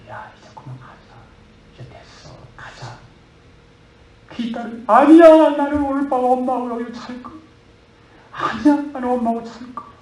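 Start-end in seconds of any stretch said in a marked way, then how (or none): 0.1-3.0 soft, near-whispered coaxing; hushed urging. Acted. Father gently urging daughter to leave. 4.9-9.9 high, loud crying refusal; wailing protest. Acted. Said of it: "통곡하면서 무덤을 끌어안고".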